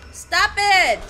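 loud, high stop it; angry shout. Real, not acted. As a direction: yell it at the attacker. Yelling at attacking object.

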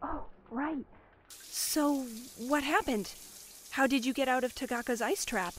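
hushed. Acted. Girl asking her friends what happened, hushed. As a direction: keep your voice down. Told to keep it down.

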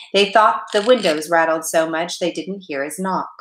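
ordinary delivery, nothing marked

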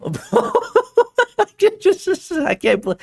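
high, drawn-out laughter breaking up the words; helpless laughing. Real, not acted. Streamer who can't believe the clip, laughing helplessly. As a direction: try to speak while cracking up. Cracks up in disbelief.

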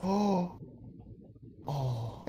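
small, soft "oh"; acted quiet dread, dropping away; sinking dismay. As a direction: play realizing the damage, hushed. Golfer sees smashed window.

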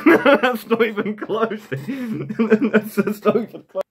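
high, helpless laughing through the words; cracking up mid-sentence. Real, not acted. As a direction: talk through real giggles. Cracking up at own bad accent.

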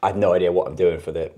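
speaking around toothbrush stick; brushing teeth while talking. Real, not acted. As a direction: stick in mouth. Seen 1: Frayed stick in mouth, rubbing teeth from 2.8s onward.

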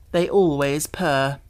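usual speaking voice

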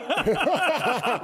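a burst of high laughter. Real, not acted. Laughing while quoting coach.

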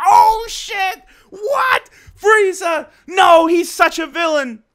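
high, loud disbelieving outburst; shocked reaction. Retold or imitated. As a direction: shout your shock at the twist. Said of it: "it's like".

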